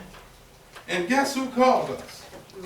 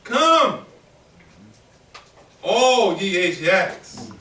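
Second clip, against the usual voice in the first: slow ringing call, louder; proclaimed call; 2 semitones lower, 5 dB louder, much slower than usual. Retold or imitated. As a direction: roep het uit als een profeet. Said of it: "he said in his calling".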